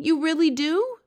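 high, loud eager question; suddenly brightening. A narrator's reading. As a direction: ask it in delighted disbelief. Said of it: "suddenly brightening".

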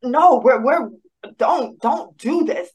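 retold distressed plea, half-crying; hurt pleading. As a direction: beg him not to go through with it. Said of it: "hurt and tormented".

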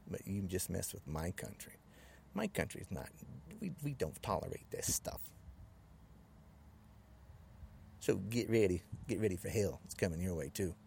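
low, soft-spoken menace; pissed off, restrained. Real, not acted. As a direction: warn them with quiet, controlled anger.

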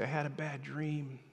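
softly voiced daughter; hushed night-time confession; small child confiding. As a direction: gently imitate a child after a nightmare.

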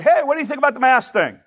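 high-pitched, chirpy question; eager conversation opener. Acted. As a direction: toss out a hot topic to a stranger. Striking up a casual conversation.

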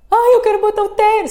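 mock toddler wail, high and loud; escândalo de birra. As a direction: shriek it like a tantrum in full swing. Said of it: "um escândalo do elevador". (choro gritado de criança fazendo birra)

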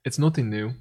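usual speaking voice

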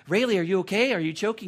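lightly acted urgent check on someone choking; alarmed sister. Girl asks if Rayleigh is choking, mock alarm. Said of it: "Karis goes".

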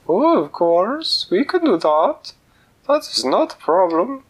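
very high, loud and slow squeaky cook; sing-song it like a tiny cook. Acted. Kitchen cook on phone.

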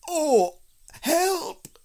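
high, loud wail from a stuck bear; panicked cry for help. Acted. Stuck Pooh calls for help, panicked.

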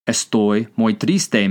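slow, evenly spaced words; careful, drawn-out demonstration; slow language-teacher model. Shown as a demonstration. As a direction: say it slowly for learners to repeat. Teacher models phrase to translate.

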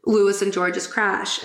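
ordinary delivery

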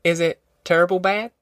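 mildly anxious mother's query; a little timid. A narrator's reading. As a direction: ask it tentatively, voice lifting at the end. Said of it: "she asked a little timidly".

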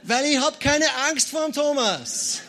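slow, high, loud proclamation; defiant shout. Acted. Preacher insisting he has no fear of Thomas, defiant shout. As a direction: bellow it out slowly as comic bravado. Comic defiant declaration after mock scream.

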